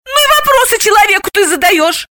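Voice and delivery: very high, scandalised scolding; indignant. A narrator's reading. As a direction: protest for the child's sake. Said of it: "возмутилась она".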